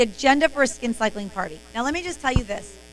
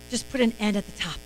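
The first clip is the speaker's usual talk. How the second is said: secretive hush; 6 semitones lower, 9 dB quieter than usual. Shown as a demonstration. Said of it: "And you go".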